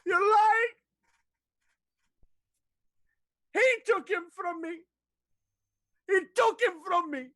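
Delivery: high, loud grief-stricken scream. Acted. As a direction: scream through tears at the liar. Doris screams at the queen's words.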